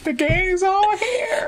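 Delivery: very high, slow cartoon squeal; SpongeBob voice. Repeating SpongeBob quote.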